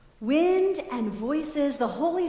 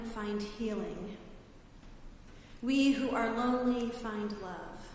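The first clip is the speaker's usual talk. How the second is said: slow, soft prayer voice; 4 semitones lower, 8 dB quieter, slower than usual. Acted. Pastor leading the congregation in prayer. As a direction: pray it gently and slowly.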